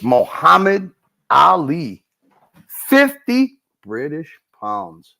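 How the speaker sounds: slow, drawn-out announcer read; mock announcer. Host announcing a big donation to chat, mock ring announcer.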